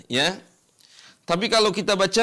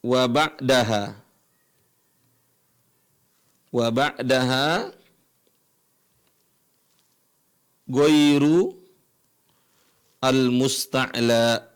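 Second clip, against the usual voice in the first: slow, lower, recited verse line; reciting the rule text; 5 semitones lower, slower than usual. Shown as a demonstration. Teacher reads the tajwid rule text aloud, slow. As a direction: intone the text line slowly. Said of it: "Kita lanjutkan di sini".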